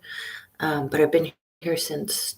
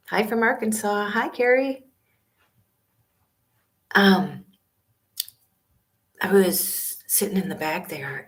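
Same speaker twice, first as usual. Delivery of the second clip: usual speaking voice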